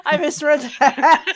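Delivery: words through real laughter; laughing. Real, not acted. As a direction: laugh while admitting the slip. Laughing at own mistake.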